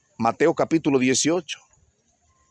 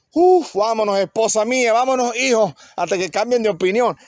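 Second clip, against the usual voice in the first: relieved hurry; 6 semitones higher than usual. Acted. Freed servant hurries family away.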